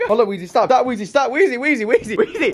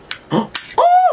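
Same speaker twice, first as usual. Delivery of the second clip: excited gasp; 4 dB louder than usual. Real, not acted. Unboxing gift, sudden gasp.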